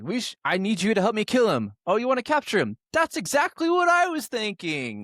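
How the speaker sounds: high put-on villain voice, hammed up; goofy falsetto for the bad guy scheming; mock villain voice. Retelling the movie villain plotting, in a high mock voice.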